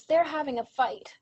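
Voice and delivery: a hint of an exasperated child; retold child caller. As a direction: imitate a girl pleading on an emergency call. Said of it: "She says".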